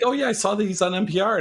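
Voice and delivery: quick, high-pitched mimicked enthusiasm; imitated excitement. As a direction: gush like the excited coworker, sped up. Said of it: "she got really excited".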